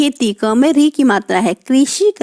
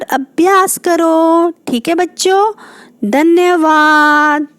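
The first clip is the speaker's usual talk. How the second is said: cheery goodbye; 4 semitones higher than usual. Real, not acted. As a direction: wave the class off sweetly. Teacher signs off to children. (बच्चों को ऊँचे, मीठे स्वर में विदा)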